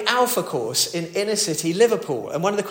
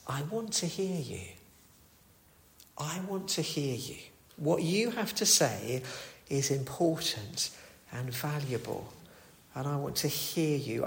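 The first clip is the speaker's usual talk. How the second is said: tender invitation; 9 dB quieter than usual. Acted. Voicing God's tender invitation.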